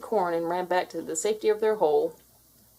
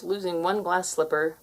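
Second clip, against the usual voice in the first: ordinary delivery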